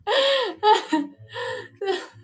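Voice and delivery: high, loud wailing burst; mock crying wail. Showing how emotional she got, wailing in bursts. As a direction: bawl high and loud, breaking up.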